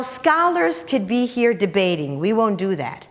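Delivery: ordinary delivery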